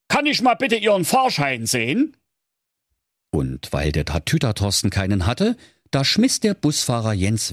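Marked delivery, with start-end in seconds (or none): 0.1-2.2 high, officious ticket check; bus driver voice. Acted. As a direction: ask like a strict bus driver. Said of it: "sprach dankbar". (strenger Busfahrer)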